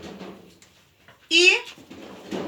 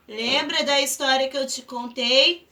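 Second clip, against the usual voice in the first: ordinary delivery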